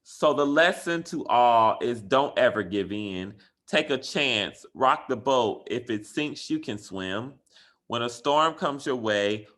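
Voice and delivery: put-on rally the kids with the lesson; reading the moral aloud. Teacher reading the story's moral to her class, upbeat.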